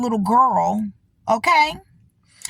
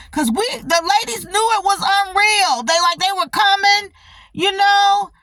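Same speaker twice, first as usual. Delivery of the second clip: worked-up retelling; 8 semitones higher, 3 dB louder than usual. Real, not acted. Heated retelling, raised voice.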